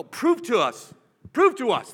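"Prove to us" flung out high and loud, twice; a crowd demanding a miracle; demanding shout. Retold or imitated. Skeptics demanding proof.

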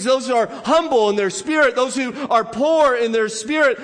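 usual speaking voice

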